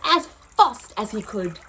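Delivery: lively storybook narration, rising and falling; reading to children. Acted. Teacher reads Lost and Found to pupils, storytelling voice. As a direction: tell the story to a class of kids.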